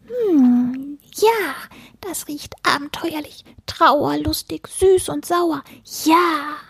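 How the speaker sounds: very high creature voice, savouring; squeaky furball in delighted anticipation; furry book-eater. A narrator's reading. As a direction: sniff and relish it like a tiny gourmet.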